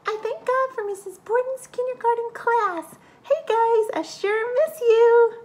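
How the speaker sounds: high squeaky mouse puppet voice; Cheddar the mouse puppet. Pretend. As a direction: chirp it out as a little mouse. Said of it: "Cheddar, can you think of".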